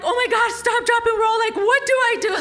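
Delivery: high-pitched panicked outburst, retold; freaking out. Wife finds a fire in her bathroom wall, freaking out. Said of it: "I'm, like, freaking out".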